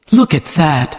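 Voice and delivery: ordinary delivery, nothing marked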